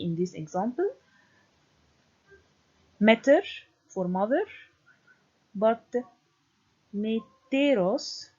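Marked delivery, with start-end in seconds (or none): 3.0-3.4 "Meter" with a loud first syllable; stress on first syllable. Shown as a demonstration. Lecturer citing a Greek example, stress on first syllable. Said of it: "placement on the first syllable". 7.0-8.3 "meteros" drawn out, stressed on "te"; stress on second syllable. Shown as a demonstration. Lecturer showing students free accent, stress on second syllable. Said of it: "te here is the stressed syllable".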